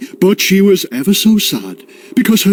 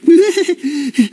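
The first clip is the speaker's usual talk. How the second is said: witchy cackle; 10 semitones higher than usual. Acted. Wise woman cackles.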